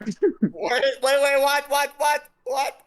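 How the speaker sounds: high, loud, stacked wait-whats; startled disbelief. Real, not acted. To co-host after an anti-British joke, stunned high disbelief. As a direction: stop everything in disbelief. Teammate hears a shocking claim.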